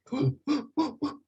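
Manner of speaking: high, short inward gasps; pulled-in monkey sound. Shown as a demonstration. Teacher shows how monkeys communicate, high ingressive gasps. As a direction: suck the sound in sharply, like a monkey. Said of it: "eles puxam, tá? Eles puxam o som".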